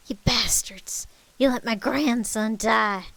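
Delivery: loud, drawn-out angry accusation; furious grieving grandmother; grandmother's yell. A narrator's reading. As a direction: yell it in outrage at them. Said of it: "Marcel's grandmother yelled".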